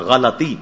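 غلطی in three syllables, "gha-la-ti"; correct pronunciation. Shown as a demonstration. Cleric modelling the right form, غلطی as "ghalati". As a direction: keep the short a after the l in غلطی. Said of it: "صحیح تلفظ کیا ہے؟".

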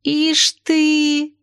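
high, drawn-out marvelling; admiring. A narrator's reading. Lyolya impressed by narrator's tale, admiring. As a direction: marvel aloud, pitch held high. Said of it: "восхитилась Лёля".

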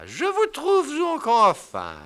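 high slow lofty indignation; haughty wife. Acted. Wife turning the tables on her husband, haughty. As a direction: play wounded dignity.

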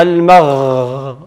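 المغ ending on a long held gh; stretched ghayn. Shown as a demonstration. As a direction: let the غ in المغ run on. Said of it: "إذن قابل للتمديد".

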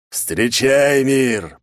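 loud villain growl. Acted. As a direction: roar it out triumphantly. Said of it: "прорычал Орион".

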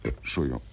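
a muffled belch on Entschuldigung; burping apology. Acted. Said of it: "rülpsen Sie denn fortwährend so ekelhaft".

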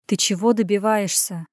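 ordinary delivery

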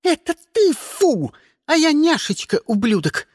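high-pitched catty voice; spiteful meowing. A narrator's reading. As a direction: mew back nastily in a high voice. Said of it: "злобно мяукнула Наоми". (злобно мяукает высоким голосом)

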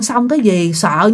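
plain reading voice

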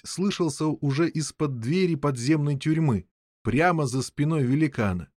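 plain reading voice

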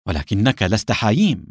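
ordinary delivery, nothing marked

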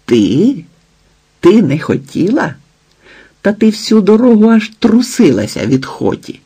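incredulous scorn. Acted. Husband rejecting wife's excuse, incredulous scorn. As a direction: throw her denial back with scorn.

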